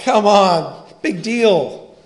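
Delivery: acted impatient grown-up scoff; dismissive adult. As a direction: brush off a child's complaint loudly. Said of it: "you're thinking as an adult".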